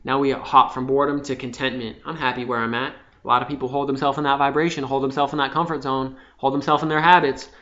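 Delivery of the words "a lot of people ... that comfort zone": normal talking voice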